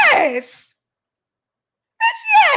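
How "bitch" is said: high, loud emphatic shout; shouted bitch. Real, not acted. Answering whether it is a problem, a high loud shout. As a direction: yell it to drive the point home.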